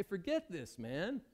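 loud, exasperated, high and fed up; bitter and fed up, voice rising high; fed-up outburst. Acted. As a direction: throw up your hands, done with it. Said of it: "Are they gonna say".

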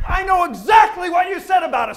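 loud indignant comeback; indignant shout. Acted. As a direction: catch them out and yell it. Daughter voiced answering in Spanish.

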